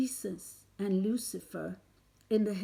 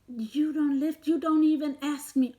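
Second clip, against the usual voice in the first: angry; 5 semitones higher, 3 dB louder than usual. Real, not acted. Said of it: "I am angry".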